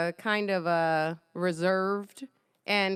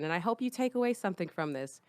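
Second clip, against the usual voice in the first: normal talking voice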